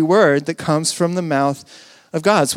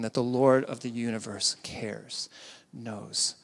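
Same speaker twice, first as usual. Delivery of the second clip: hushed awe; 4 semitones lower, 6 dB quieter than usual. Real, not acted. Pastor to congregation on Jesus weeping, hushed whisper. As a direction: let it fall to a near whisper.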